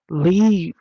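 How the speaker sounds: outburst. Real, not acted. Outrage at caseworker wanting her gone.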